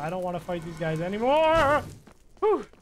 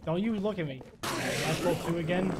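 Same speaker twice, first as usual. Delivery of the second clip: ordinary delivery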